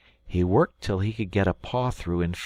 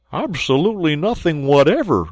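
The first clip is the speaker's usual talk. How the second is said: truthful; 7 semitones higher, 8 dB louder than usual. Acted. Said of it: "with perfect truthfulness".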